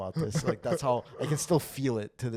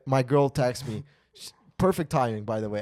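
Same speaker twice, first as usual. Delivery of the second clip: ordinary talk, nothing marked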